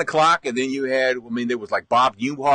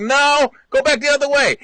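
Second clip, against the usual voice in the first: high, louder call back; 10 semitones higher, 4 dB louder than usual. Retold or imitated. Mom calling up to dad on the roof, loud and high. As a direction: shout directions back up. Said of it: "She was like".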